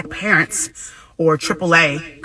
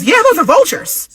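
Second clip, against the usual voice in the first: higher, mock casual man's answer; 12 semitones higher, 6 dB louder than usual. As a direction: toss it off like it's nothing. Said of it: "He goes".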